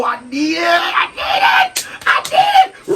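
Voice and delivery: high shouted pleading, building; desperate craving. Retold or imitated. Hooked on an ex, desperate high yells. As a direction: beg for it, losing control. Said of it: "got you like".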